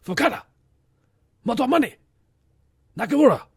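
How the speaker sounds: hammed-up Japanese accent, shouted high. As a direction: yell the names in a mock Japanese accent. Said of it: "every name in a Japanese accent".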